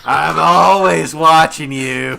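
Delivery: loud, slow, drawn-out menace; Roz's threat. Retold or imitated. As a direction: stretch it out like a sinister clerk. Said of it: "the person from Monsters, Inc".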